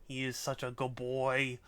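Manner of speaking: ordinary talk, nothing marked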